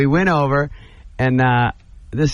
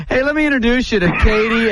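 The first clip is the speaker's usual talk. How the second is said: pushy fan, mock voice; 9 semitones higher than usual. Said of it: "guys walk up to me and go".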